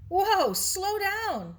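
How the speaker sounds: loud, slow, drawn-out whoa; startled call. A narrator's reading. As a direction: call out to stop her. Mary stops running Sarah.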